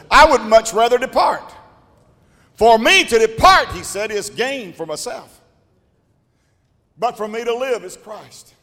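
loud, high preaching cry; full-force preaching. Acted. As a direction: preach it out with fire.

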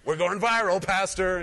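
mock bystander glee, laughing; laughing mock quote. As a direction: tease it through a laugh. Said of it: "it's like".

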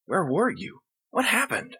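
worried partner asking; anxious questions. Acted. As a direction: ask urgently.

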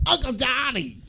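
high-pitched mock holler; mock yell. Film fan imitating a guy in the movie, mock yell. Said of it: "some guy yells".

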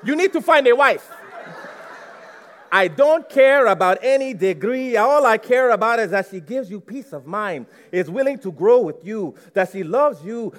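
loud, insistent Nigerian mom; mother's voice. Retold or imitated. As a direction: lay down the law as his mother. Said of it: "I'm gonna say it to you as I heard it".

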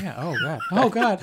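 high squeals of laughter; laughing. Real, not acted. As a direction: laugh it out, caught red-handed. Caught after calling Dustin names.

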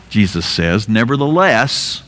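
usual speaking voice

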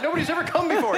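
high, laughing disbelief; panicked witch. Retold or imitated. Witch panics as the dead really appear, high and laughing. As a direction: blurt it out in stunned disbelief.

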